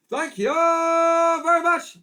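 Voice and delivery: high, loud and slow; over-the-top comic sign-off. Acted. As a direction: belt it like a lounge comic.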